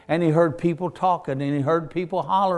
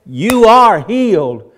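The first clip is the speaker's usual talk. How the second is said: firm declaration; 5 semitones higher, 11 dB louder, slower than usual. Acted. Jesus declaring healing.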